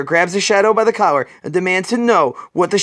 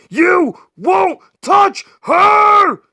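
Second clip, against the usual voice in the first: high, loud, slow roaring threat; fierce shout; 13 semitones higher, 5 dB louder, much slower than usual. Acted. Batman fights Stag, slow high roaring shout. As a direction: stretch every word as you fight.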